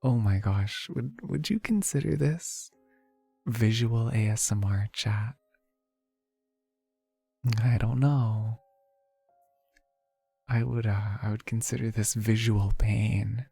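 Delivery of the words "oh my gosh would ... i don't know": ordinary talk, nothing marked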